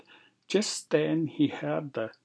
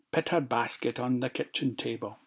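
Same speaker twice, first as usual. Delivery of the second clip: usual speaking voice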